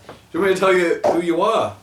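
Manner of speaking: high, loud imitation of an MC; retold showman's call; mock announcer. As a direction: project the question to a whole crowd. Announcer addressing him before crowd.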